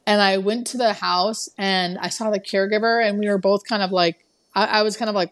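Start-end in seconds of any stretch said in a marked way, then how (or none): none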